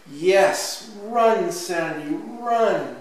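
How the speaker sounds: slow, emphatic storybook encouragement; urging on. A narrator's reading. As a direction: send the child off with a loud, excited call. Mama urges excited child on.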